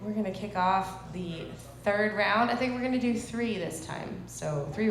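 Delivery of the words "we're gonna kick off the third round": ordinary delivery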